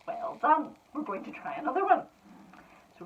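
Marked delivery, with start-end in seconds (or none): none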